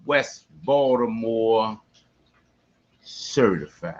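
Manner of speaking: low, slow, soft drawn-out sign-off; deep slow tag line. Acted. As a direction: drop the voice for the signature line.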